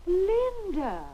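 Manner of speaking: high, stretched cry of surprise; astonished exclamation. Acted. Mother hearing Linda is engaged, astonished.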